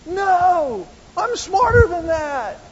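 high, drawn-out mock outcry; indignant protest. Belittled person jumping up to object, indignant. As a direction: protest slowly in a high, strained voice. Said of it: "like jumping up and saying".

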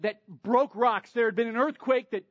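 ordinary delivery

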